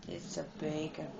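quiet repeat. Shown as a demonstration. Gamer repeating his line quietly under his breath. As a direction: mumble "It's the bacon" to yourself.

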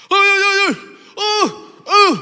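loud high pleading grunts; urgent grunts asking for the fruit; imitating a mute man. Preacher acts the mute man begging, loud high grunts. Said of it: "saya hanya untuk nyontohin".

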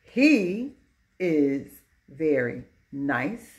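slow, every word set apart; word-by-word reading. Shown as a demonstration. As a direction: read it out one word at a time. Teacher reads corrected example word by word.

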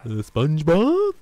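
SpongeBob attempt. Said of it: "I can do SpongeBob".

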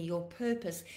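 normal talking voice

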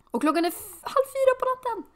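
high, loud shocked cry. Real, not acted. Player shocked it is half past three at night. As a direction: gasp out the time in disbelief. Shocked at late in-game hour.

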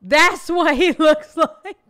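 loud, slow, drawn-out shock; shocked laughing. Real, not acted. Co-host reacting loudly to how the comedian looks. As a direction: gasp it out in disbelief.

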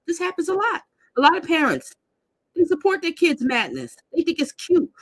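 fed-up commentator ranting to her viewers; indignant rant. Real, not acted. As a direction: vent it, exasperated. Ranting about parents' support.

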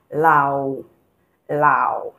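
"Lao" going down and coming up; falling-rising tone. Shown as a demonstration. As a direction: dip the pitch inside "Lao". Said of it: "you're going down and coming up".

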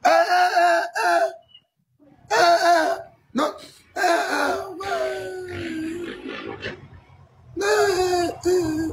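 high, crying yelps of alarm; panicked wailing eh. Acted. Interviewee wailing in panic at the drug question. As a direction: wail like you've been accused.

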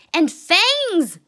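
high, loud storybook screech. A narrator's reading. Chester adds to the bully's horrors, screeching. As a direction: screech it like an alarmed cub. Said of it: "screeched Chester".

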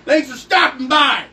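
loud, high, drawn-out thanks; shouted sign-off. Real, not acted. Host closing the segment, loud shout. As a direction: holler it to the audience.

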